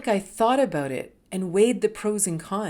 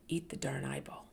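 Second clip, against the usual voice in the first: hushed self-pep talk; 6 semitones lower, 10 dB quieter than usual. Retold or imitated. Urging herself on inwardly.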